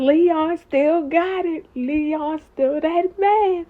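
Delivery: high, stretched-out hype; drawn-out admiration. Real, not acted. Host admiring Leon's flirting.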